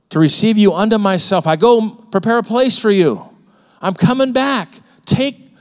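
loud, high, ringing preacher's voice; full-force preaching. Acted. Pastor urging discouraged believers to take courage, loud preaching. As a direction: proclaim it like a trumpet call.

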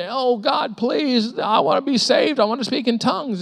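high, pleading cry to God; crying prayer. Retold or imitated. New believer begging God to be saved, tearful plea. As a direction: beg God through tears, voice up high. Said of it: "Vicki and I were crying".

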